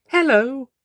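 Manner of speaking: bright high cheerful greeting. Acted. Doctor greeting the horse, high cheerful hello. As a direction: chirp it up high. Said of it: "the doctor said cheerfully".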